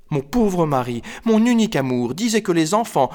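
high, wistful woman's voice; gushing, plaintive high voice; sentimental wife. Acted. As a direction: lament your lost love, pitched high. Woman fondly recalls late husband.

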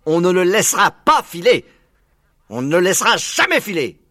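high, loud determined declaration; loud vow. Acted. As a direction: swear it out loud, pas and jamais punched. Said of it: "déclara Buns".

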